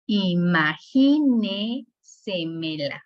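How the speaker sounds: imaginésemela slowed into i-ma-gí-ne-se-me-la; syllable by syllable. Shown as a demonstration. Teacher demonstrating sobresdrújulas, imaginésemela slowly syllable by syllable. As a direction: draw out imaginésemela one syllable at a time. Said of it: "sobresdrújulas cuando la sílaba tónica es antes".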